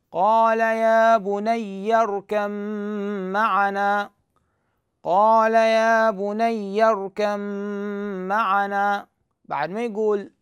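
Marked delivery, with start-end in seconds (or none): 0.1-9.1 slow, louder chanted recitation with ب merged into م; Quranic recitation. Shown as a demonstration. Teacher reciting the verse aloud to students, slow chanted recitation. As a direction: chant it slowly and fully, اركب معنا merged. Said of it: "شلون يقراها؟".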